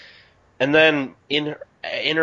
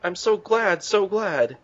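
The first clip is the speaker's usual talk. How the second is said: mocking echo; 7 semitones higher, slower than usual. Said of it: "She's a broken record".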